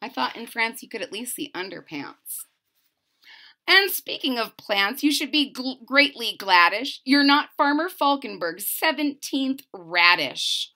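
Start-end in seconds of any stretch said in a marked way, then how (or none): 3.7-10.7 lively, bouncy read-aloud of rhymes; storybook narration. A narrator's reading. Reading a rhyming book to a child, lively narration.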